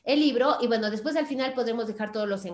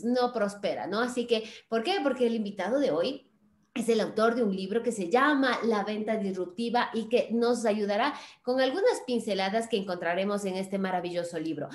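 usual speaking voice

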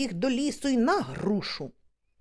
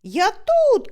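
loud high shout; shouted reply, pitch up; 8 semitones higher, 6 dB louder than usual. Retold or imitated. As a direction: yell back from up the pear tree. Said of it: "кричу".

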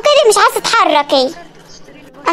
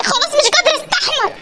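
exasperated wail; 6 semitones higher, 4 dB quieter than usual. Acted. Daughter fed up with grandmother.

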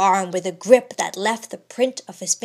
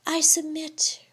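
calm; 7 semitones higher than usual. A narrator's reading. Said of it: "replied she calmly".

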